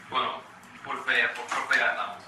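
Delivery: ordinary talk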